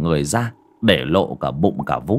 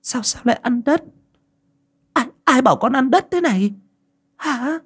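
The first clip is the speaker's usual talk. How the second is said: high, urgent repeated questions; mother questioning. A narrator's reading. Mother pressing her daughter, high and urgent. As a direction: press the child for answers. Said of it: "hỏi đi hỏi lại".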